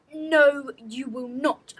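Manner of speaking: loud sharp headmistress bark. A narrator's reading. Headmistress barks at the fidgeting girl, loud and sharp. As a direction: snap the refusal like an order. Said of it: "barked the headmistress".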